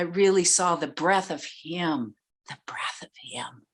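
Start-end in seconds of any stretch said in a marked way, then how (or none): 2.5-3.6 hushed, breathy whisper. Real, not acted. Honoring her teacher's breath, a reverent whisper. Speaker repeats phrase, then whispers it.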